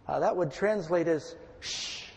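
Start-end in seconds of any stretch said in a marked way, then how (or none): 1.6-2.1 soft unvoiced shh, much softer; hushing shh. Shown as a demonstration. Pastor paraphrasing Jesus to the sea, soft shush. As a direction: hush the storm gently.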